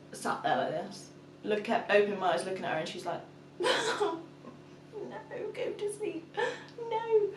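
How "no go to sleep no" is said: very high little voice; put-on bedtime voice. Bedtime standoff between mum and toddler, high mimicked voice. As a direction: act it out in a tiny high voice.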